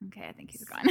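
giggly hushed whisper. Real, not acted. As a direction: whisper through a laugh.

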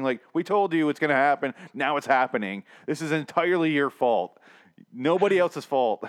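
loud, hammed-up blaming; worked up. Host acting out blame at a removed passenger, worked up. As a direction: scold loudly in mock exasperation. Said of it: "I'm getting worked up here".

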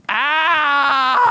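high, loud cry of pain; hammed-up cry of hurt; mock ow. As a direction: yelp it out. Acting pain again.

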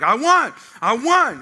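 loud gloating cheer of victory; triumphant shout. Retold or imitated. Preacher mimics believer crowing loudly over beaten devil. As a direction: crow it out like you just won.

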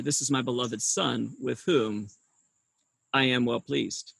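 low, soft divine pronouncement; voice from heaven. Acted. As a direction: speak as God, hushed and low. Voices God speaking from heaven.

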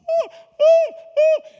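imitated high loud shrieks; piercing mock cries of a woman losing control; wigging out. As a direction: shriek the ahs like someone overcome. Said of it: "this lady starts wigging out, like, just like".

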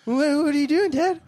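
high-pitched mock little girl; mock daughter. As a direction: whine up at dad like a confused kid. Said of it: "She's like".

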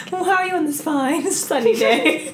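put-on high, laughing old lady voice; sweet granny greeting the young men, laughing; mock grandma.

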